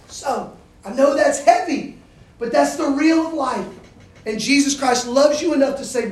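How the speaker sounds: full-force preaching. Acted. Preacher to freshmen, building to the good news, full-force preaching. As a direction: drive it home to the room.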